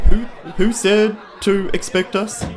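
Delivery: ordinary talk, nothing marked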